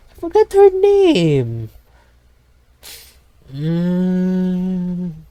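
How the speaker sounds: high, loud, slow squeak; cartoonish high-pitched whine; squeaky silly voice. Joke line in silly voice.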